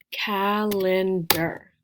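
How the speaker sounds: "calendar" very slowly, in three separate beats; syllable by syllable. Shown as a demonstration. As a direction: stretch out cal, en, der in "calendar". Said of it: "Three syllables".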